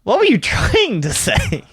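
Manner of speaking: high, drawn-out baffled question; stretched-out, high-pitched disbelief; amused bafflement. Real, not acted. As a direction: ask it high and incredulous. Baffled by co-host's garbled word.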